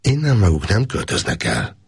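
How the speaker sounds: flat, decisive statement; firm, resolute. A narrator's reading. Gábor tells the hunter they won't move, firmly. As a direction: lay down the law, no arguing. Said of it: "mondta határozottan".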